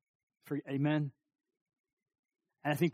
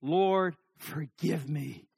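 slow prayer, a pause after Lord; slow, separated, earnest plea; deliberate heartfelt prayer; much slower than usual. Shown as a demonstration. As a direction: weigh each word as you pray.

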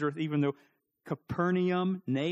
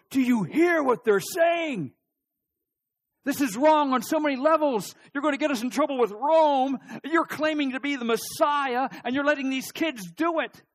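much higher and louder, outraged protest; indignant scolding; 9 semitones higher, 7 dB louder than usual. Retold or imitated. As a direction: rebuke him like an alarmed temple leader. Priests scold Jesus at the temple.

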